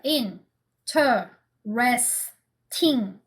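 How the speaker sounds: "Interesting" very slowly in four separated beats, in-te-res-ting; syllable by syllable. Shown as a demonstration. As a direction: count out "Interesting" with a pause after each syllable. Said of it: "interesting 몇 음절입니까?". ("Interesting"을 네 음절로 또박또박 끊어서)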